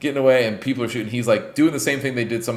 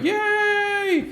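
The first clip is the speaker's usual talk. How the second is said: squeaky high crowd cheer; mock crowd cheer. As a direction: cheer like a brainwashed mob. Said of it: "everyone's like".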